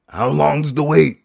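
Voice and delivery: put-on high, loud frail old man asking. Barber imitating old man asking the wait again.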